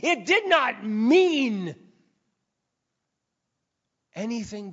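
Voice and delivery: high, loud pulpit declaration; emphatic preacher building to a point; full-force preaching. Real, not acted. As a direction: preach it out, stretching the last phrase.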